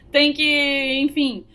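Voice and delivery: breaking into real laughter mid-phrase; laughing. Real, not acted. Teacher laughing to students about the French pout.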